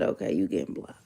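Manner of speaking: whispered, low deadpan; flat muttered verdict; a cold whisper. Retold or imitated. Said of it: "I said".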